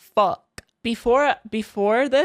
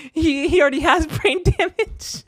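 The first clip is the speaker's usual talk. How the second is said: higher, laughing mid-sentence; laughing jab; 7 semitones higher, 4 dB louder than usual. Retold or imitated. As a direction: tease with a giggle. Mocking friend, laughing.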